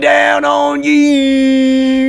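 high, slow shouted wrestler promo; wrestling promo voice. Retold or imitated. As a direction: cut a big WWE promo. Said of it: "cutting W W E promos".

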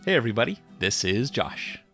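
normal talking voice

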